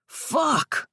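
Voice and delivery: a furious biker's roar. A narrator's reading. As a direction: bellow it out in rage. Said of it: "He roared".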